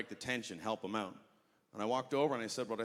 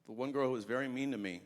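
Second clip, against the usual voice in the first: normal talking voice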